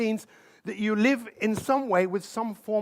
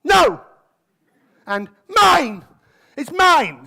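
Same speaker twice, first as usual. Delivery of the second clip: mock toddler yelling, higher and louder; hammed-up baby protest, high and loud; toddler shouting; 5 semitones higher, 8 dB louder, much slower than usual. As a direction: shout like a grabby little kid. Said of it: "first words that most babies learn to say".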